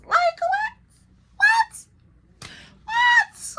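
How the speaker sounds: very high, loud squawked question; exaggerated disbelief. Acts out disbelief at the accusation.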